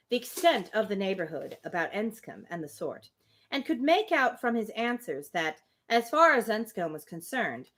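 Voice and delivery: steady, measured reading of the novel; story narration. Acted. Reading Emma aloud to a friend, measured narration. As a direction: read the Austen passage aloud, even and clear.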